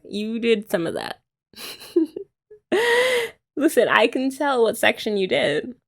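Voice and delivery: ordinary talk, nothing marked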